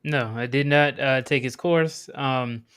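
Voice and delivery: normal talking voice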